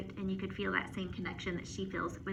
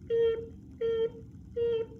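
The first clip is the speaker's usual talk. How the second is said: very high, even beeps; truck beeping; 12 semitones higher, 3 dB louder, slower than usual. Acted. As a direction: mimic a reversing truck.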